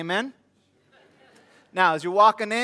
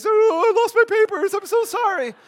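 much higher, louder, hammed-up blubbering apology; fake crying; 14 semitones higher, 8 dB louder than usual. Dad pretending to cry to get back in line, hammed-up sobbing plea. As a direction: wail it like a grown man faking tears. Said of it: "When a grown man cries".

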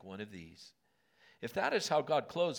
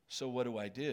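ordinary talk, nothing marked

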